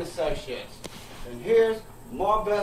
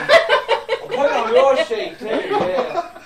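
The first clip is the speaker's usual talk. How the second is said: laughing confession; 7 semitones higher, 7 dB louder, much slower than usual. Real, not acted.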